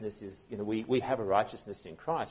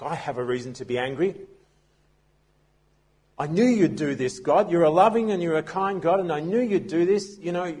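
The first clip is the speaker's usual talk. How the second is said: voiced as upset Jonah, pushed higher and louder; angry outburst; 6 semitones higher, 6 dB louder than usual. Preacher voicing Jonah ranting at God, loud and aggrieved. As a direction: rail at God for his mercy.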